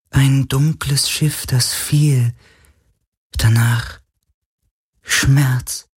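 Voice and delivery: hohe, langsame, schwache Geisterstimme; klagender Geist. Acted. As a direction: wie ein sterbender Geist klagen. Geist erzählt Schmerz. (hohe, traurige Geisterstimme)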